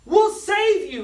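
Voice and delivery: very high, loud rallying shout; boy charging to the rescue. A narrator's reading. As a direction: shout it bravely. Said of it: "he shouted".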